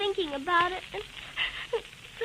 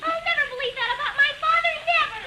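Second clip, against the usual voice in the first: defiant denial; 9 semitones higher than usual. Acted. Daughter defends her dead father.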